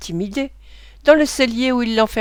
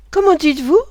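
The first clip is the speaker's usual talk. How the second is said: sharp, higher startled question; 5 semitones higher, 4 dB louder than usual. A narrator's reading. Olivier reacts, stunned, to the insult about his mother. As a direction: snap up in disbelief. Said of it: "demanda Olivier en levant bien vite la tête".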